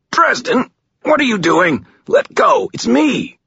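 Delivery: high, loud, frantic pleading; pained gasping protest. A narrator's reading. Captive begging his attacker to let go, pained. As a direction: cry out in pain to be released. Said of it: "he gasped".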